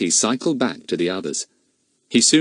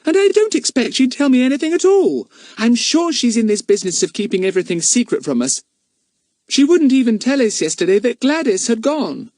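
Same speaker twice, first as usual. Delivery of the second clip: put-on much higher, a bit louder young girl; complaining little girl; a young girl grumbling, pitched high; little girl's voice; 5 dB louder than usual.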